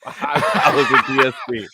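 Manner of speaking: real laughing through the words. Real, not acted. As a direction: crack up while getting it out. Both hosts cracking up at joke.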